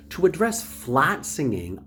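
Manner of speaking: normal talking voice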